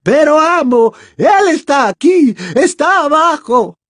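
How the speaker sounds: very high, loud, desperate wail; frantic warning. Acted. Bellatrix warning Voldemort he is below, frantic. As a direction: warn the master in a panic. Bellatrix warns her master urgently.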